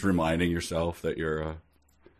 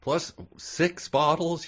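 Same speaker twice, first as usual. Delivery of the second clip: higher, slow disbelieving question; higher, slower mock disbelief; incredulous echo; 9 semitones higher, slower than usual. Real, not acted. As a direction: drag it out in disbelief.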